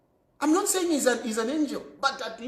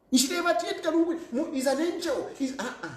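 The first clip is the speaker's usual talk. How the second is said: usual speaking voice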